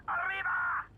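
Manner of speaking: hammed-up gleeful shout; triumphant cry. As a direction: throw out a victorious battle cry. El Burro's boastful rallying cry.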